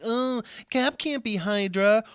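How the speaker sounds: mock the complaining fans; whiny fan voice. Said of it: "people who's like".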